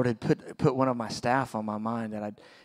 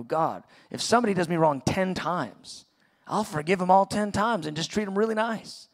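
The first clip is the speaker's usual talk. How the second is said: rising higher and louder, speeding up at the end; self-justifying plea; 6 semitones higher, 4 dB louder than usual. Retold or imitated. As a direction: argue your case with God, building fast. Arguing with God, justifying self.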